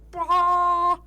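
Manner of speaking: loud harsh sonar blare; loud mock sonar "Bah!"; imitated sonar blast. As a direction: blast a fake sonar noise. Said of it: "it sounds like".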